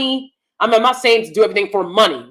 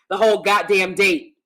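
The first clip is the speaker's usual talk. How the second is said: usual speaking voice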